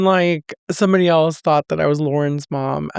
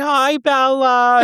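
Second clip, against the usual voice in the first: high, slow, sugary fake-nice hello; sweet to her face, poison behind it; fake sweet greeting; 9 semitones higher, slower than usual.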